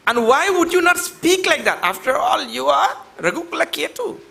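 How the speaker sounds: high, emphatic rhetorical question; sage addressing Ram. Shown as a demonstration. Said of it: "understand in that kind of tone".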